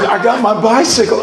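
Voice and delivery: put-on high-pitched kid's voice; playful mock child voice; little boy. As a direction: imitate a small boy piping up. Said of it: "one little boy said".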